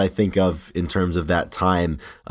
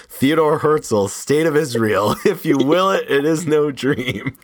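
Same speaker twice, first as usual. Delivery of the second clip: higher, slow, drawn-out declaiming; imitating pompous Walter; 6 semitones higher, slower than usual. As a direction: quote it grandly as a self-styled intellectual, stretching the endings. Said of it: "he's always talking above his intelligence level".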